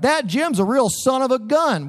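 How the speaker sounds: high, hammed-up goody-goody voice; mock prim curse. Pastor joking about childhood cursing, prim high mock voice. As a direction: play the squeaky-clean kid cursing. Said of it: "cursing like".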